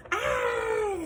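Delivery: high, loud startled shriek; startled scream. Acted. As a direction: shriek as if something landed on you. Elephant discovers bird on head.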